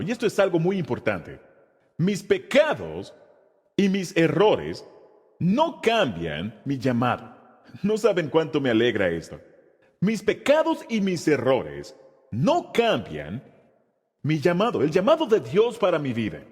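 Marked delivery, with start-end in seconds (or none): 2.0-7.4 slow declaration. Acted. Pastor declares key truth. 10.0-14.8 slow declaration. Acted. Repeats key truth slowly.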